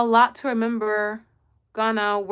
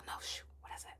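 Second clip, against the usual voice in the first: whispering; 27 dB quieter, much faster than usual. Real, not acted. Forgot the sushi name.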